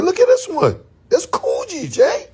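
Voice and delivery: high excited pointing it out. Retold or imitated. Showing Jay another piece, excited and high. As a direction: push it up high, thrilled.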